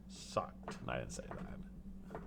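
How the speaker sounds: breathy whisper, slow; whispered aside. Real, not acted. Streamer muttering what he'd never tell his daughter, whispered. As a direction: let the insult slip out under your breath. Joke aside, unsaid insult.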